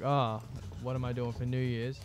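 ordinary delivery

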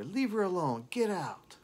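high furious yell; angry shout. Retold or imitated. Sister yelling at the singer to leave. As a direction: scream at him to get out. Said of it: "her sister did shout".

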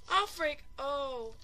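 normal talking voice

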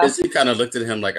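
ordinary delivery

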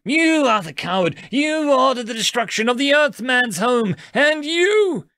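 high, loud accusing outburst; angry shout. A narrator's reading. Youngest accusing the eldest, angry shout. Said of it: "He shouted at him".

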